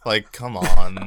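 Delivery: slow, loud laughing protest. Real, not acted. Laughing loudly at the co-host's awful intro. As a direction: laugh it out in mock exasperation. Exasperated joke about co-host.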